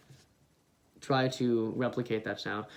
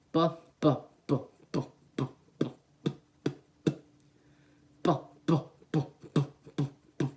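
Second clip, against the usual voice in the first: slow bu bu, the vowel fading out; slow kick drill. Shown as a demonstration. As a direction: ease the vowel out beat by beat. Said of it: "why you see me do this with my hand".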